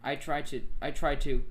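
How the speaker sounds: ordinary delivery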